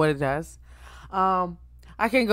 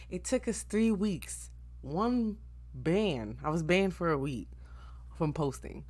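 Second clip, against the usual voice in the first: ordinary talk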